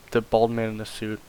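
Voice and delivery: ordinary talk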